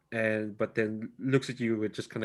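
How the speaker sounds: normal talking voice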